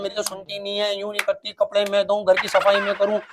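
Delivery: low, soft mock-mother lament; imitating complaining mother. Mother on the phone about her lazy daughters, mock lament. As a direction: moan on like an overworked mother. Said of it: "उस दिन phone पे बात कर रही है".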